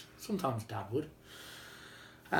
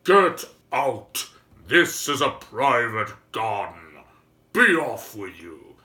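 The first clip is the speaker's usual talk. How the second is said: put-on loud booming giant roaring at children; angry giant shout; 2 semitones lower, 10 dB louder, slower than usual. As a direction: bellow it slow and huge like an angry giant.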